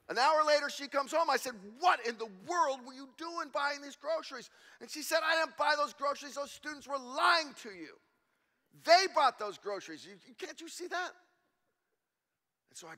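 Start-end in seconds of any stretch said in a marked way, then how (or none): 1.8-4.5 loud, incredulous question; husband half-shouting at wife over groceries; mock exasperated demand. Pastor confronting wife, retold. 5.2-11.1 loud, insistent wife's voice; wife setting the pastor straight, insistent; imitating the wife. Wife voiced scolding him.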